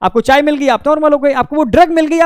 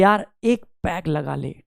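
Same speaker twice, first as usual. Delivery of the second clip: low, soft, slow coaxing from a friend; 7 semitones lower, 8 dB quieter, slower than usual. Retold or imitated. Friend coaxing the student into one peg, low and slow. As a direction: tempt him gently into one drink. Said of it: "आपके दोस्त ने कहा कि".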